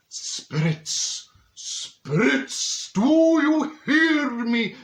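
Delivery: hammed-up medium, high, loud and slow; a fake psychic calling the spirits with theatrical drama; mock psychic summoning. Mock medium calling spirits to the seance table, hammed-up and slow.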